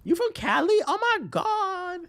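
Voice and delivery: squealed high, slow on oh my God; hammed-up starstruck gasp; mock shock. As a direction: act amazed like it's exotic. Mock shocked reaction to Californian.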